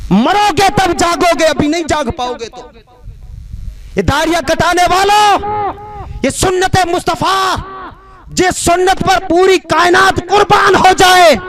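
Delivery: high exhortation building to a shout; full-force preaching. Acted. Preacher rebuking those who cut beards, full-force preaching. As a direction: rebuke the crowd, ringing out each phrase.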